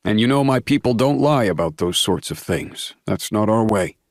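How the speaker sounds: proud, booming dad; father voice. A narrator's reading.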